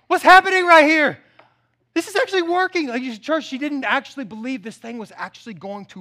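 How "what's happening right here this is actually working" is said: high, loud, hammed-up astonishment; shocked witch. Fraud witch finds her trick working, mock shocked shriek. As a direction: shriek it in comic disbelief. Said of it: "And she's like".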